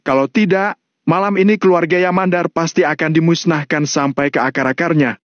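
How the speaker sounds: menacing Valentino. Acted. Valentino threatens the Yamandar family, menacing.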